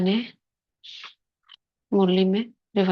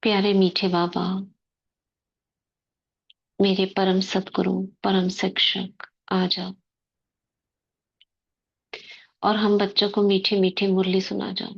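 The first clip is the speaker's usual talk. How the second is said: guided meditation. Acted. Sister guiding the class into soul-consciousness before murli, meditative.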